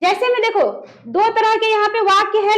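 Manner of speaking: normal talking voice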